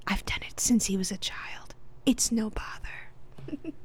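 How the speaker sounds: acted stage whisper. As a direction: whisper it ASMR-style. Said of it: "this is all in whisper".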